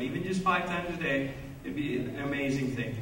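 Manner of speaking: ordinary delivery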